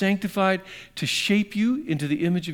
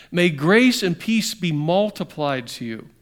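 slow scripture reading; 3 dB louder, slower than usual. Acted.

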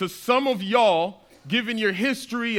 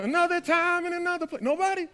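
high mock plea; 9 semitones higher, 4 dB quieter than usual. Real, not acted. Pleading for song recognition.

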